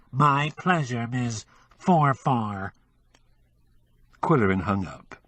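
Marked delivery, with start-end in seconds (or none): 0.1-2.7 slow, nasal disguised voice. Acted. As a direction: pinch the sound into the nose. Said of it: "with an adenoidal twang".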